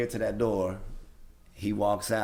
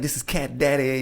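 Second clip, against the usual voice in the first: higher, louder radio DJ announce; 7 semitones higher, 5 dB louder than usual. Retold or imitated. Caller pretending to be the station DJ, hyped announcer voice. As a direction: pitch it up like a hyped on-air host. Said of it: "I'm acting like I'm the D J".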